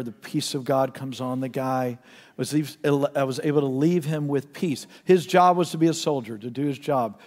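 usual speaking voice

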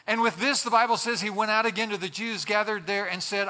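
normal talking voice

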